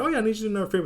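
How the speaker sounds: high, cheery request; bright high-voiced casual ask; perky Rimuru voice. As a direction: pitch it way up, breezy. Rimuru asks casual favor.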